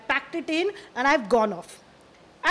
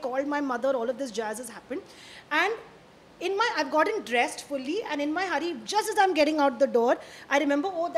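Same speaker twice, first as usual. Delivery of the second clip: ordinary delivery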